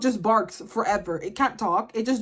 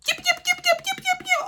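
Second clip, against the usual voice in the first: very high yapping dog barks; imitated yapping. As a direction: yap like a tiny Pomeranian. Imitating Pomeranian barking.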